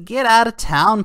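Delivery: "Get out of town" thrown out high and loud, punch on "out"; scoffing dismissal. Real, not acted. Vlogger scoffing at Putin's crypto warning, high and loud. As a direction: scoff it like you can't believe it.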